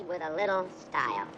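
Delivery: ordinary talk, nothing marked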